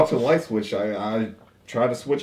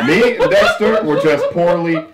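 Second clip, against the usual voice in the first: nervous laughing denial; 8 semitones higher, 6 dB louder than usual. Acted. Flustered suspect denies with laughter.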